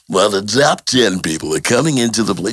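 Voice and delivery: smooth radio DJ announcing a countdown. Pretend. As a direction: play it like a radio DJ. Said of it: "the radio voice".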